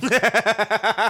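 high laugh. Real, not acted. Laughing at cast pairing.

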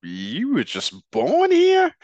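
slow, high, loud incredulous jab; mock disbelief. Host acts out the fighter scoffing at a rival's birthplace. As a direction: act out his scoffing surprise. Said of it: "He's saying".

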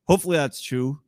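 ordinary delivery

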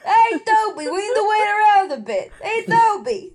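high, loud character call; exaggerated, high-pitched role voice; searching for character voice. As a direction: call out to Toby in a put-on voice. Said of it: "what is my voice?".

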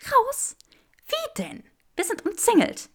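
high, startled disbelief; alarmed. A narrator's reading. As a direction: panic rising in her voice.

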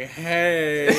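high, loud joke-horse greeting. Retold or imitated. Homeless man delivers his horse joke punchline, imitated campy horse.

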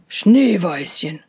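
slow, low bear voice calling the name; the bear drawing out the girl's name. Acted. Bear calls the girl.

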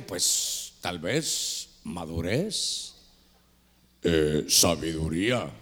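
very slow, low, drawn-out answer; mock self-important musing. Old pastor explaining why girls noticed him, slowly pondering. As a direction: ponder each word in a low voice.

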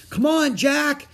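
high, loud shout; shouted urging. A narrator's reading. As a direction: shout to hurry a friend along. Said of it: "Annie shouted".

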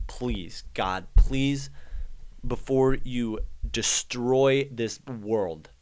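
normal talking voice

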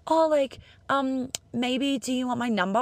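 high, half-hearted, hedging ask; timid mock ask for a number; hesitant ask. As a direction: dangle the offer without committing. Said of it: "being half in, h-, half out".

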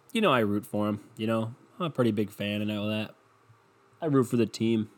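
ordinary talk

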